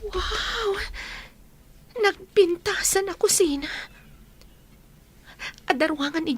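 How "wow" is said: delighted surprised wow. Acted. Mother marvelling at the new house, surprised. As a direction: gasp at the beauty.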